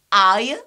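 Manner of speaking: "I" loud, rising, as "eye-uh"; triphthong pronunciation. Shown as a demonstration. Teacher listing what we have, "I" as rising "eye-uh". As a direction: glide "I" into schwa with a list rise. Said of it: "We have".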